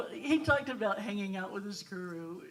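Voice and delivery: loud words through laughter; laughing while talking. Real, not acted. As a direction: keep laughing as you speak. Laughing through her question.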